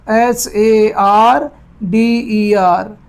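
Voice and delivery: letters H A R D E R said one by one, slowly; slow spelled-out letters; spelling out. Shown as a demonstration.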